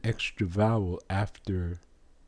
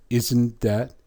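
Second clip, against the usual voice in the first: ordinary talk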